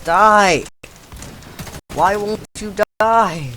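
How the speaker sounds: yelling at the screen, frustrated; furious shout. Real, not acted. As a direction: shout it at the enemy. Shouting at enemy in game.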